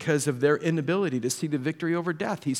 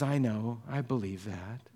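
downcast; 5 semitones lower, 9 dB quieter than usual. Acted. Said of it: "Head down".

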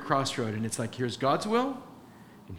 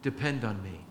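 soft, slow plea; God's tender slow plea; gentle pleading; 4 semitones lower, 5 dB quieter, much slower than usual. Retold or imitated. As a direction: beg softly and slowly. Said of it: "the Lord is pleading".

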